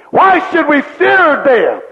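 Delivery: normal talking voice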